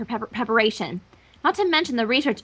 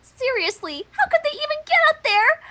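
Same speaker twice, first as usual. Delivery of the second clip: frantic disbelief; 12 semitones higher than usual. Acted. Twilight doll panicking at the impossible climb, squeaking high. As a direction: pitch flying high in alarm.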